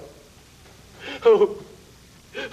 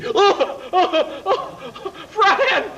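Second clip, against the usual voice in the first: panicked cries; 11 semitones higher, slower than usual. Acted. Dragon begs Fran not to look at his tooth, in high panicked cries. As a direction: shriek at her not to look.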